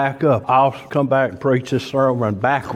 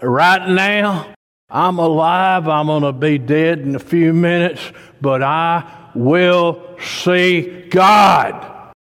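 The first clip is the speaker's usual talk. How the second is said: joyful at death; 5 semitones higher, 4 dB louder, slower than usual. Pretend. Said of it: "I want you to embrace it and say".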